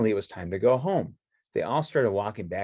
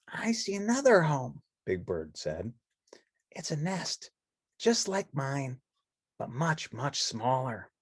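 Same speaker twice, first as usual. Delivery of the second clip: high, hushed, gentle bird voice; Big Bird voice; 5 semitones higher, 3 dB quieter, slower than usual. Acted. Big Bird showing the nest, hushed and high. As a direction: softly point it out as Big Bird.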